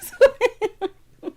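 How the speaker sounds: high giggly laugh. Real, not acted. Laughing after confession.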